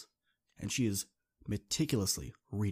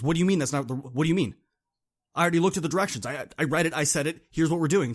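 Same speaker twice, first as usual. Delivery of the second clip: louder, indignant pushback; defensive; 6 semitones higher, 8 dB louder, faster than usual. Retold or imitated. Dean snapping back at Jasmine's correction, loud and defensive. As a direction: bristle and defend your plan.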